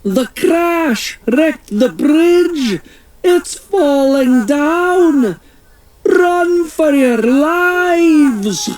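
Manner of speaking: high, slow, drawn-out monster warning; Loch Ness Monster. Acted. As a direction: urge everyone to flee, slowly. Nessie warns of falling bridge.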